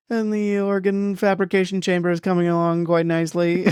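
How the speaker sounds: high, sing-song alien leader voice; Mathesar. Retold or imitated. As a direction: imitate an odd, high-voiced alien giving a tour. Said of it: "Mathesar is taking them on a".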